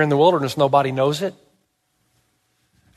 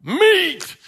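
high, loud, chanted demand; mock riot chant, pitched high; rioting crowd; 7 semitones higher, 4 dB louder, much slower than usual. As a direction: clamour like an angry mob, higher on each demand. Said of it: "People are rioting".

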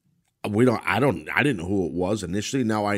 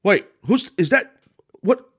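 high, loud, startled bewilderment in broken bursts; playing dumb; 11 semitones higher, 6 dB louder than usual. Shown as a demonstration. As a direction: act like an announcer who can't believe who it is. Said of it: "you have to say something like this".

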